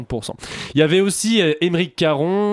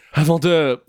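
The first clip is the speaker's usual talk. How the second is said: ordinary delivery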